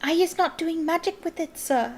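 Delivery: high, tearful squeal; squealing house-elf. A narrator's reading. Winky pleads in tears, squealing elf voice. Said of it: "squealed Winky".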